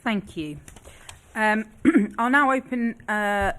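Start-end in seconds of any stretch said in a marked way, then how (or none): none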